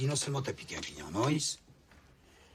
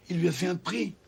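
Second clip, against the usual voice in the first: scornful; 6 semitones higher, 6 dB louder than usual. Acted. Boss repeats the excuse scornfully.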